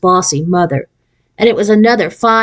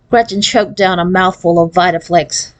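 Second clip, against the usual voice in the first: usual speaking voice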